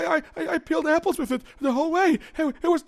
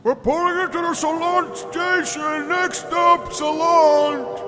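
train conductor announcement; 3 semitones higher, 5 dB louder than usual. Acted. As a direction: call the stop like a conductor.

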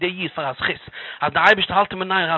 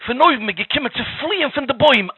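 plain reading voice

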